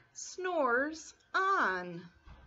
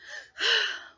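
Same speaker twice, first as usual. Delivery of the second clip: happy sigh; 10 semitones higher than usual. Acted. Bear sighs with delight.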